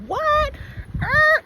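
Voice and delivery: a sudden high-pitched "What?" of amazement; startled heaven. Retold or imitated. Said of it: "leaning over going".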